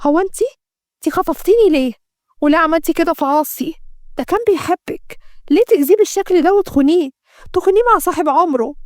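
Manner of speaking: high-pitched, hurt disbelief; shocked. A narrator's reading. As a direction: confront a betrayer in shock. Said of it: "ليلى بصدمة". (بصدمة واستنكار)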